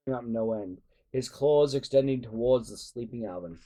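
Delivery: usual speaking voice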